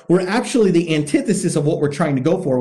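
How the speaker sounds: ordinary talk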